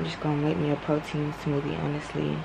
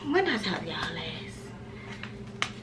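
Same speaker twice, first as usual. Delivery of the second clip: whispering; 9 dB quieter, much slower than usual. Real, not acted. Musing to camera.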